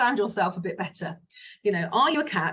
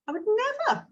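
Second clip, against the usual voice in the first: "I would never" lifted high on NEVER; high intonation. Shown as a demonstration. Coach demoing self-questioning prompt, said high. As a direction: float it up high, a different tune. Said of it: "in a different intonation of voice".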